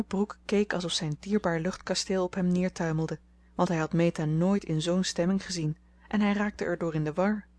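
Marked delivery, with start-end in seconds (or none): none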